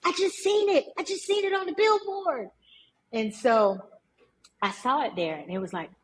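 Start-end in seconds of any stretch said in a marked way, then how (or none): 0.0-2.5 high-pitched, breathless-with-news mock holler; retold excited hollering. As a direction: relive the thrill, crying out high to a friend. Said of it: "I'm hollering".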